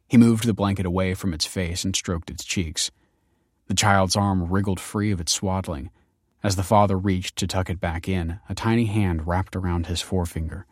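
plain reading voice

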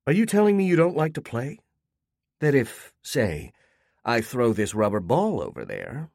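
high, lilting puzzled lady; condescending neighbor talking to a dog; puzzled Lorna. Acted. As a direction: coax him like a pet.

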